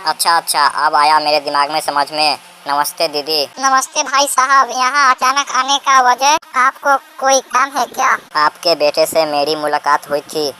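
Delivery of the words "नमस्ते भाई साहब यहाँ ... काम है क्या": put-on very high-pitched old mother-in-law voice; mother-in-law character. Mother-in-law asks the visitor why he came, inquiring. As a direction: greet and question in a high put-on voice.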